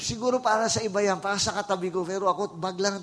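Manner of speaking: high, loud pleading excuse; mock sheepish visitor. Visitor confessing to the pastor, high sheepish pleading. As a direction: squeak out the excuse like a caught thief. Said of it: "di ko nga alam ba't ako nag-church".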